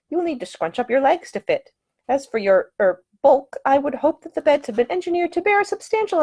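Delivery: high, precise lady's voice; earnest know-it-all heroine; young woman explaining the engineering; prim young lady. Acted. Ida explains bunk engineering.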